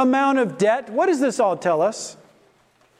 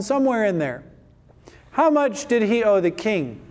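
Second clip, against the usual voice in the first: ordinary talk, nothing marked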